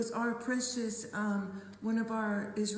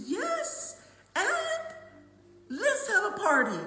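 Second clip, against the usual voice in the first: high, louder, jubilant party call; excited yes; 11 semitones higher, 6 dB louder, slower than usual. Retold or imitated. Friend answering Debbie with a thrilled high party invitation.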